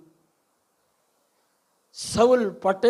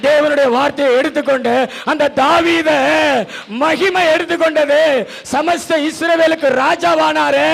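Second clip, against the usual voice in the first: loud, fervent sermon delivery; booming pulpit cadence; rising preaching; 5 semitones higher, 7 dB louder than usual. Acted. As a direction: drive the promise home to the congregation. Preaching at full force.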